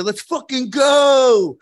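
drawn-out high, loud cheer; hyped bro yell. Retold or imitated. Locker-room guys cheer his cold plunge record, hyped bro yell. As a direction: whoop it like a pumped-up gym bro. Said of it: "is that the tone of your voice".

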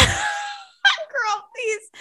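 very high squeal through laughing; squeaky, laughed-out words; helpless laughter. Real, not acted. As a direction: plead through a fit of laughter. Laughing at embarrassing confession.

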